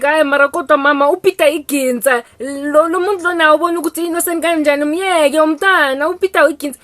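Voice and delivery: loud, fast pleading son; protesting son. Retold or imitated. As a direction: talk mom out of it quickly. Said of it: "this guy's like".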